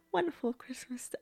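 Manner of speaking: breathy whisper, half sung, trailing off; soft whispered jingle dying away; whispered sing-song. Pretend. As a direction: whisper the Christmas tune as it fades out. Sings Christmas, fades to whisper.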